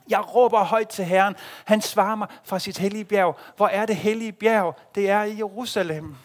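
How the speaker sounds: rising loud cries to the Lord; impassioned prayer. Acted. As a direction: pour out the psalm from the heart. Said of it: "hvisker, råber, græder de her ord". (inderlig, råbende bøn)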